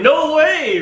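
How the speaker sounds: high, loud protest; mock scream. Cohost imagining women objecting to his flower opinion, mock scream. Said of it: "some women are probably screaming".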